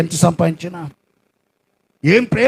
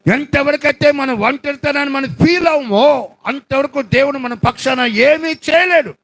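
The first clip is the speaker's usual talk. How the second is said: full-force preaching; 6 semitones higher than usual. Acted. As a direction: proclaim the loneliness with force. (గట్టిగా బోధించే స్వరం)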